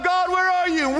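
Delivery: crying out. Retold or imitated. Crying out to God.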